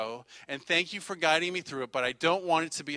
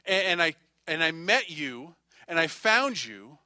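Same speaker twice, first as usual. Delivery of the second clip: preaching; 8 dB louder than usual. Acted. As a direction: hammer each phrase home to the congregation. Opening lines of sermon.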